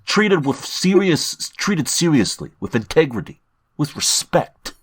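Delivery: put-on Jersey wiseguy accent, drawn out; slow New Jersey tough guy read. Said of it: "Put on your best Boston accent or New Jersey accent".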